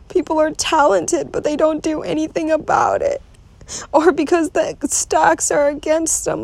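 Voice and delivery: high, tearful voice breaking into sobs; anxious, weepy worrying aloud; real crying. Real, not acted. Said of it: "I'm starting to cry".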